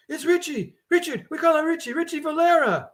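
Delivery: high, frantic pleading cry. Acted. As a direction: blurt the name in a panic. Frantic woman names the man.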